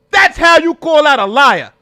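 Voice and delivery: loud, high hyped commentary; excited loud. Real, not acted. Streamer praising the interviewer, loud and excited. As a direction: keep the hype going.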